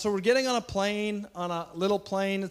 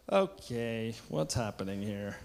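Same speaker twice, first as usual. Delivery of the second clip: low, puzzled mock voice, with a laugh in it; bewildered newcomer; 8 semitones lower, 5 dB quieter, slower than usual. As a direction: play a confused first-timer, pitched lower. Confused newcomers voiced in church.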